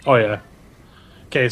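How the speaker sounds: ordinary talk, nothing marked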